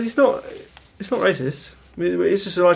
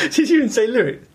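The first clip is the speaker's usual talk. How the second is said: laughing; 9 semitones higher than usual. Real, not acted. Laughing incredulous question.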